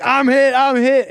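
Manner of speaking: half-hearted yell of a cop; retold scream.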